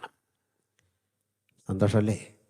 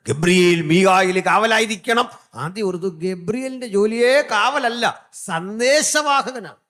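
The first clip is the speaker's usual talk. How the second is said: emphatic preaching; 9 semitones higher, 4 dB louder than usual. Acted.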